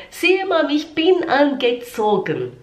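high-pitched son's reply; child answering mother. Acted. Son tells his mother he is dressed, high child voice. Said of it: "ou ele fala".

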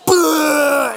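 a loud, high retching blast; vomit burst. Retold or imitated. Boy hanging out the car window, loud retching burst. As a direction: mimic the barf spraying out.

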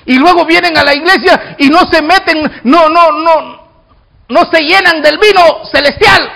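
high, driving sermon delivery; forceful preaching. Real, not acted. Preacher scolding churchgoers who don't get filled, forceful. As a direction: preach it hard to the congregation.

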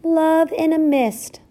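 put-on slow, very high tiny child; little piping voice. A narrator's reading. Smallest mouse tells mother its name, piping slowly. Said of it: "piped a little voice".

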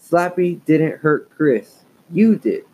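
slow, firm scolding; stern father. Acted. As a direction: lay down the law slowly.

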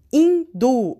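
Hindú, high and loud, strong dú; stress on DU. Shown as a demonstration. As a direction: call out "Hindú" as a model word, hitting DÚ. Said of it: "hindú es el du".

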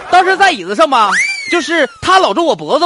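loud, high, worked-up retelling; excited shouting. Real, not acted. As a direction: blurt it out all worked up.

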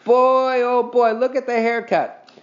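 mocking glee. A narrator's reading. Scooter mocks Henry's haircut, gleeful jeering. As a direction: jeer at the haircut. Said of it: "began to laugh".

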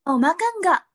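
mock Japanese accent on "Gue mau makan". Shown as a demonstration. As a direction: play a Japanese learner saying slang. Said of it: "coba dengan logatnya dia".

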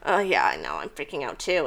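low, soft aside; quiet admission. Retold or imitated. Parasailer privately admitting fear beside partner, low quiet aside. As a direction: drop low and soft, owning up. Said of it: "Not even looking. And I'm like".

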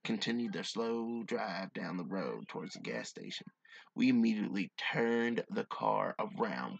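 low, measured storytelling read; hushed-down, deliberate campfire-tale delivery; story narration. A narrator's reading. Reads a story aloud.

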